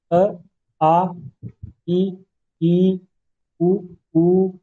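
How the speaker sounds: अ short then आ drawn long, each pair short then long; short and long vowels. Shown as a demonstration. Teacher demonstrates vowel length to students, short then long. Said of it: "अंतर समझ रहे हैं".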